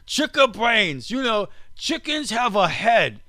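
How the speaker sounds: high, slowish joking Aussie voice; mock Australian accent. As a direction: lighter caricature of an Australian old man. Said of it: "do I sound like an Australian?".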